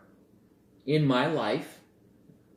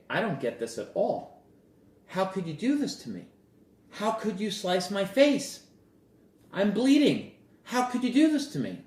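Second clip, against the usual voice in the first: high-pitched, indignant protest building louder; acted wounded outcry, climbing higher and quicker; hurt disbelief; 9 semitones higher, 3 dB louder than usual. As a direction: plead in rising outrage at a betrayal. Acts hurt victim confronting the bear.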